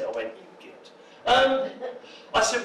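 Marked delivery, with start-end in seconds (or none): 0.4-0.9 barely audible insult under the breath; muttered aside. Retold or imitated. Guest reacting to studio interviewer's question, muttered under his breath. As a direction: mutter it so the interviewer can't hear.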